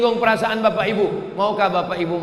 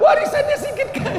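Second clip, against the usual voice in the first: much higher, put-on whiny widow voice; mock weeping widow; faster than usual. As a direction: ham up a tearful widow. Widow complains about inheritance.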